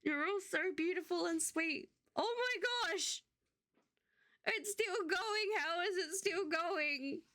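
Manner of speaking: slow, high sobbing disbelief; overwhelmed, crying through the words; happy crying. Real, not acted. Streamer watching gifts keep coming, happy sobbing. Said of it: "so nice to have a happy cry".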